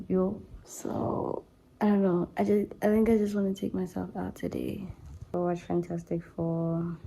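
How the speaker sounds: diary entry. Acted. Speaking softly to his diary about a bad day.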